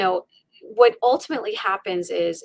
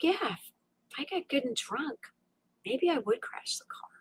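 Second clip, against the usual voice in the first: much softer, trailing into a whisper; hushed dark thought; 6 semitones higher, 10 dB quieter than usual. Retold or imitated. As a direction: think it aloud, secretly. Retold dark private thought.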